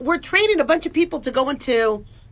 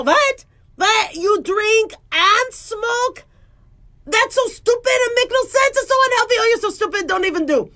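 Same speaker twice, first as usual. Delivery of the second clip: high, scolding Israeli accent; exaggerated Israeli accent, indignant and high-pitched; 9 semitones higher than usual. Shown as a demonstration. Doctor scolding a patient who drinks and smokes, Israeli accent. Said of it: "Um, Israeli".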